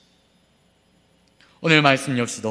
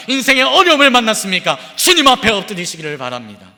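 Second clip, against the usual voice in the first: rising, louder exhortation with repeated questions; full-force preaching; 9 semitones higher, 8 dB louder than usual. Acted. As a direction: drive it home, climbing each line. (힘차게 외치는 설교)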